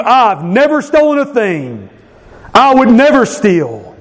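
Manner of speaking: high, prim self-righteous boast; puffed-up Pharisee bragging, pitched high; mock Pharisee. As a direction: play the smug holy man. Mocking voice of Pharisees.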